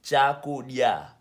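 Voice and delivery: Chakudya slow and loud, split as cha, ku, dya; Chakudya, carefully drawn out for learners; syllable-by-syllable pronunciation. Shown as a demonstration. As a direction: sound out Chakudya in separate syllables. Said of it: "That's how you pronounce it".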